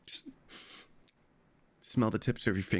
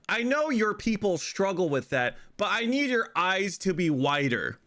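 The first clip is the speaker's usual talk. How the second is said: high, louder photographer voice; photographer's voice; 10 semitones higher, 4 dB louder than usual. Retold or imitated. Photographer insisting on wider eyes, high and pushy. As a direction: push the line up high and firm. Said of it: "He's like".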